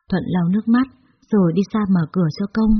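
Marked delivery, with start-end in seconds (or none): none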